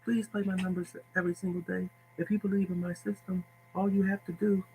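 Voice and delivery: high, soft breathy-sweet [name] voice. Retold or imitated. Tipster mocking a gentle pitch to followers, soft high [name]. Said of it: "talk like [name]".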